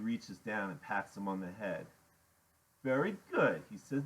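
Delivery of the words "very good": higher, louder patronizing approval; condescending praise. A narrator's reading. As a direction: praise him like a pet. Iggy pats Freak, condescends.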